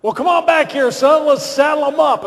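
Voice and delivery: rally him like a cheerful commander; 1 hearty boss voice. Retold or imitated. President voiced, urging him.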